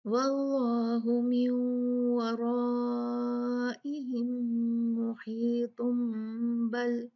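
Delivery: slow recitation, long vowel in वराइहिम, hummed m in मुहीतुम; iqlab recitation demo. Shown as a demonstration. Teacher reciting without a stop, मुहीतुम drawn into बल on a long hum. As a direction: chant it slowly, holding the m of मुहीतुम into बल. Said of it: "इकलाब का कायदा लगाएंगे".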